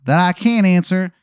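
over-the-top Maryland voice; Shithead Steve's Maryland accent. Acted. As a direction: announce yourself in a horrible Maryland accent. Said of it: "the accent is just".

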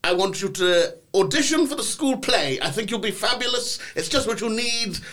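a hint of a dreamy drama teacher coaxing; gushing hippie teacher. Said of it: "She didn't sound like that, but that's what I imagine".

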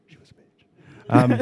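slow, unvoiced whisper of an insult. Real, not acted. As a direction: whisper it like a secret aside. Whispered insult about ex-wife.

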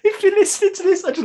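high-pitched, laughing aside; amused, voice lifted by laughter. Real, not acted. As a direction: say it through giggles. Laughing at Mystic Meg idea.

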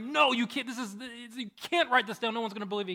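high, agitated objection; acted-out frantic scribe; alarmed protest. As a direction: object in disbelief, pitch up.

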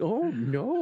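high, drawn-out mock "oh no"; hammed-up comic dismay. Acted dismayed reaction in retold story.

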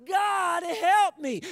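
high, drawn-out shout for help. Acted. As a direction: stretch out a desperate scream. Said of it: "He screamed yet louder".

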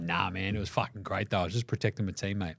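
low, quiet mock excuse; dismissive player. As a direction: shrug it off low and under the voice. Acts out Draymond's offhand reply.

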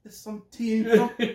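high, loud mock British voice; British accent. Co-host adding to the British bit, high mock accent. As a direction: pipe it up in a put-on British accent. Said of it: "spoke in a British accent".

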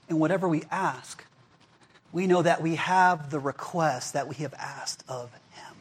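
whispered, slowed reading; whispered verse. Real, not acted. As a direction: breathe the promise out in a whisper. Reading scripture verse intimately.

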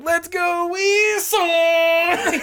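high, slow, laughing chant; drunk fan shout. Retold or imitated. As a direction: yell it again, half laughing. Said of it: "I just kept being like".

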